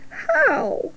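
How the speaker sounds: small, high, soft squeak; high, timid little voice; anxious piglet. Acted. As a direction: ask it meekly in a high pig voice. Panicking piglet asks Charlotte.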